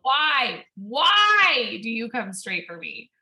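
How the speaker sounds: loud, drawn-out cry of dismay; exasperated mock wail. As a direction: wail it slowly at the cat. Retold exasperated plea to cat.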